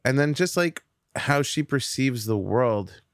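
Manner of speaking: usual speaking voice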